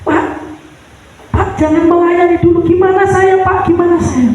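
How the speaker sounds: high, fast, pleading cries; wife begging her husband to stay home; desperate plea. Retold or imitated. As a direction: rush the words, begging in grief. Grieving wife begs husband to stay.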